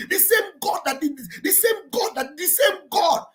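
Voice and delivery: loud, slow preaching, rising to cries; fiery preaching. Acted. Host preaching to Biafran listeners, fiery and impassioned. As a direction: preach it hard, hammering the same God. Preaching at full force about God.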